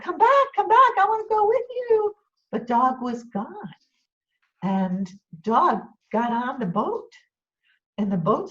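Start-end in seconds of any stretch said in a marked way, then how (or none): 0.0-2.1 high, loud call after a leaving friend. Acted. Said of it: "Rabbit's calling out".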